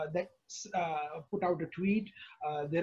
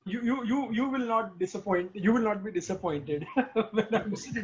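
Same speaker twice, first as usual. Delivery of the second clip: laughing; laughing while talking. Real, not acted. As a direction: chuckle through the promise. Promise to the guest, laughing.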